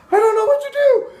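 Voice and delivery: high, loud mock-girl squeal; hammed-up shrieky female voice; panicked girl. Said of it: "one girl gets jumped out of the bed and she goes".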